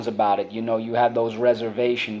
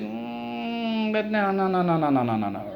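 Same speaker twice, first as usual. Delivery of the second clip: long high hum sliding down into a run of no-no-no; skeptical child; 10 semitones higher, 4 dB quieter than usual. Acted. Acting a doubting five-year-old.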